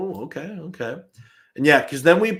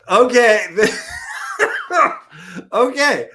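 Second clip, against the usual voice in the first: high, loud okay through real laughter; cracking up; 10 semitones higher, 7 dB louder than usual. Real, not acted. Streamer reading a viewer's motel reply, cracking up. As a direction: burst out laughing mid-read.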